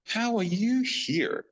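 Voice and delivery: high, startled question in another voice; lightly voiced astonished producer; surprised. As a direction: ask it in disbelief.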